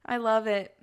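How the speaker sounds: ordinary delivery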